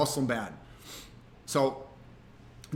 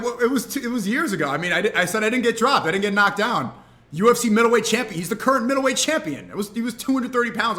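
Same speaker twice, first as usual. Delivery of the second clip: higher, louder flustered self-justification; retold defensive protest; 6 semitones higher, 5 dB louder than usual. Streamer explaining old sparring to fighter, defensive protest. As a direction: protest your case as if under accusation.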